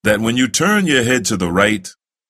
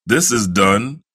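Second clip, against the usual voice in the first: ordinary talk, nothing marked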